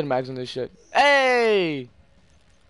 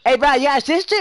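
higher, louder imitation of a friend; mock friend voice; 10 semitones higher, 6 dB louder than usual. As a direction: play the pushy friend, lightly. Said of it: "He's like".

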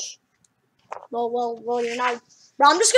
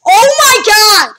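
high, loud outburst; exasperated cry; 6 semitones higher, 8 dB louder than usual. Real, not acted. Gamer interrupted by a phone call, exasperated cry. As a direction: cry out in real frustration.

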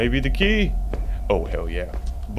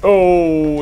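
startled; 6 semitones higher, 8 dB louder than usual. Real, not acted. Monster behind him.

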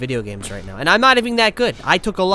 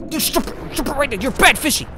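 flustered scolding; 6 semitones higher than usual. Real, not acted. Scolding the attacking fish.

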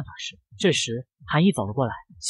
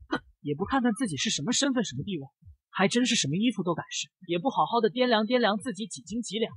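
put-on higher, softer and sneering, like a spoiled rich girl; sour, biting voice; 5 semitones higher, 6 dB quieter than usual. A narrator's reading. Snob sneering at a shop customer, sour and biting. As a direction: look down on her with every word. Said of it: "尖酸刻薄的声音".

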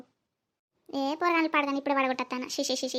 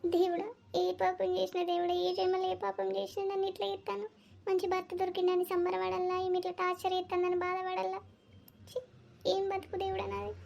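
lament to God; 3 semitones higher, 6 dB quieter than usual. Acted. Lamenting to God about her torment.

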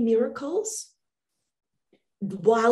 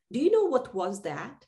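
ordinary delivery

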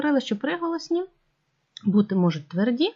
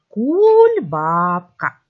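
Кульбабка stretched very slowly, louder; drawn-out sounding out; 6 dB louder, much slower than usual. Shown as a demonstration. Teacher sounding out a word for pupils, drawn out. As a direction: sound out Кульбабка, lingering on each part. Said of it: "коли вимовляємо це слово".

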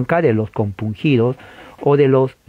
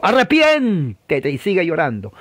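exhorting preacher; 3 semitones higher than usual. Acted. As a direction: imitate a preacher calling for repentance. Acting preacher urging repentance.

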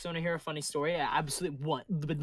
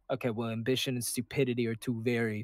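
usual speaking voice

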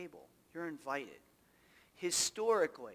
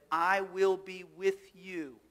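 slow solemn promise; 5 dB louder, much slower than usual. Acted. Quoting Jesus's promise slowly.

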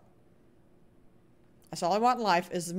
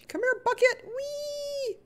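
very high, silly coaxing voice; high-pitched playful call; playful squeal; much slower than usual. Real, not acted. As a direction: squeak it out and end in a long whee. Playfully calling the bucket.

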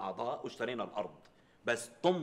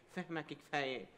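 quiet coy wife; 5 dB quieter, slower than usual. Retold or imitated. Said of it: "بتتغماني وبتقول لي".

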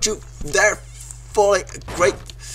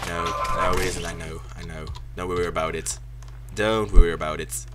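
low mock reassurance; 12 semitones lower, 6 dB quieter than usual.

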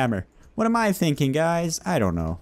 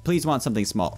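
normal talking voice

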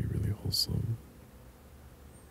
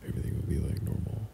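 normal talking voice

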